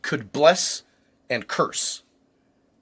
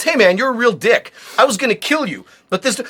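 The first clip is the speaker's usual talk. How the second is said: scolding angel; 7 semitones higher, 4 dB louder than usual. Acted.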